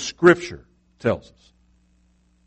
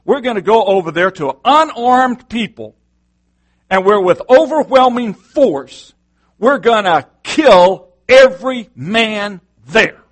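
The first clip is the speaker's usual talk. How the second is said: angry orders; 6 semitones higher, 4 dB louder than usual. Retold or imitated. David rallying his men for an attack, a hammed-up angry command. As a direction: bark out the order to kill every man. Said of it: "He tells his men".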